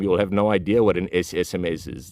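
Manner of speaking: ordinary talk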